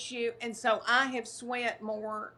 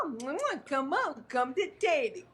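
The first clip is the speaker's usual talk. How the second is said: lightly voiced husband coaxing, higher; coaxing dogs over; 4 semitones higher than usual. Husband calling the beagles to his side, higher coaxing. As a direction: sweet-talk the dogs to come to him. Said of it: "and goes".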